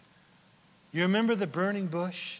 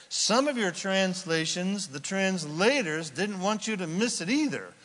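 ordinary delivery

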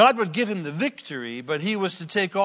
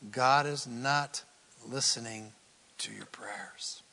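dropping to a whisper; 4 semitones lower, 5 dB quieter, slower than usual. Acted. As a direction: fade from slow and soft into a whisper. Preacher drops to a whisper.

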